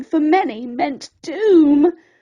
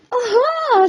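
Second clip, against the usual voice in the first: high, bright aha; spring on the discovery; delighted aha; 7 semitones higher, 3 dB louder than usual. Acted. Snippy spotting the way out, excited. Snippy spots the open door.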